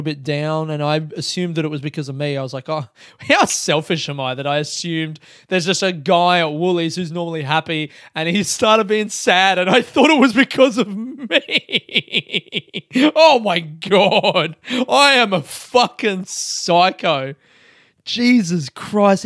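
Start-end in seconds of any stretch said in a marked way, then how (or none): 2.7-17.3 hammed-up horror at yourself, stretching the last words; laughing, over-the-top self-disgust; mock self-reproach. Mock horror at own self-centredness. 18.0-19.2 loud, drawn-out exclamation; hammed-up disgust at yourself; mock exasperation. Mock horror at own self-centredness.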